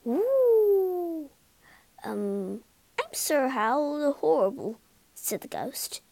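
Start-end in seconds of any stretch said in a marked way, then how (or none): none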